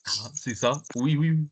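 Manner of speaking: ordinary delivery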